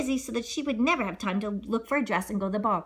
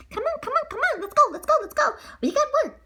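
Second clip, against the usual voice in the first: high excited mouse. Acted. Mice rushing, laughing.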